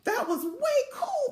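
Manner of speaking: high, excited exclamation; delighted. A narrator's reading. Principal telling Velma it was way cool, high and delighted. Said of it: "the principal said".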